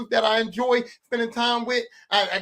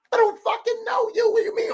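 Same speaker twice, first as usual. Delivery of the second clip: incredulous retort; 13 semitones higher than usual. Retold or imitated. Repeats retort to strange kid.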